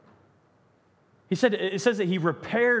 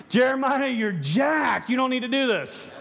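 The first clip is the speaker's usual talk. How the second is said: raised, higher voice calling him out; mock rebuke; 7 semitones higher than usual. Preacher voices blunt rebuke.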